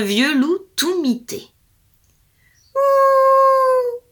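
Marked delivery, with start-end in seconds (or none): 2.7-4.0 loud, high crying ouh; crying howl. Acted. Old wolf howling in pain to the fairy. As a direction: howl out the wolf's toothache. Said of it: "pleurait l'animal".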